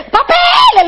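put-on very high squeaky kid's yell; child yelling. Kid in the bathroom yelling to the aunt, squeaky child's yell.

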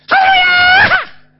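aleluya gritado, muy agudo y alargado, lento; grito de aleluya. Retold or imitated. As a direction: estalla de júbilo para espantar al diablo. (grito de aleluya muy agudo y lento)

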